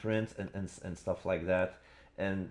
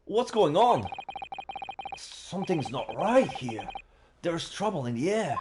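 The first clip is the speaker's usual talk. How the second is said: Ryunosuke voice; 8 semitones higher than usual. Acted. Voicing Ryunosuke waking up.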